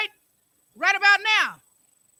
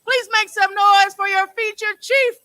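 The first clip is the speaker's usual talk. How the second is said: hype shout; 6 semitones higher, 7 dB louder than usual. Real, not acted. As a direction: pump up the crowd loudly. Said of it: "hyping the crowd for the poet".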